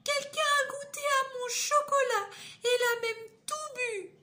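voix très aiguë et plaintive de petit ourson; le petit ourson se plaint, tout aigu; petite voix d'ourson. Acted. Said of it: "Et le petit ourson dit". (Le petit ourson découvre son bol vide, voix très aiguë)

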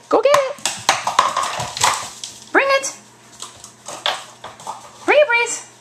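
bright high call to the dog; high dog-talk voice. Real, not acted. High-pitched dog fetch commands.

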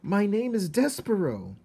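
shout. A narrator's reading. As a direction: call it across the room. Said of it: "shouted to the princess".

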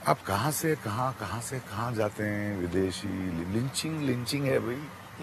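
very soft and slow, drawn out; slow right down and drop the volume; weary protest. Real, not acted. Guest protests host's leap to foreign agencies, quiet and slow.

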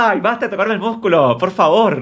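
high-pitched, exasperated mock plea; exasperated protest. Retelling a protest at the muscle-touching boyfriend, exasperated. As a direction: act out begging him to stop.

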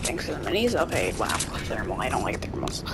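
low whispered grumble. Real, not acted. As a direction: whisper it under breath. Whispered aside.

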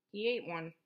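ordinary delivery, nothing marked

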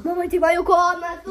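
excited call to mom; calling out loud. Real, not acted. Child calls mom about room.

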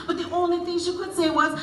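ordinary delivery

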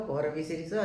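ordinary delivery

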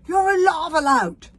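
high, loud accusation; furious accusing shout; roar. A narrator's reading. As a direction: roar it at an enemy. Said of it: "roared Stoic".

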